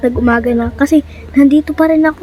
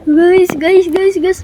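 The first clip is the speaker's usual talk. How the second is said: panicked calling; 5 semitones higher, 3 dB louder, much slower than usual. Real, not acted. After attack, panicked calling.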